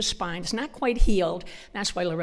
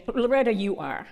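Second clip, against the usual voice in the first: usual speaking voice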